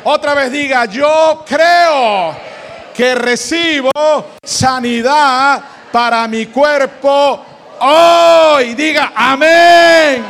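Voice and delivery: shouted, slow, high declaration of healing; preaching at full force. Acted. Preacher to congregation, repeat after me, full force. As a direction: proclaim it to the back row.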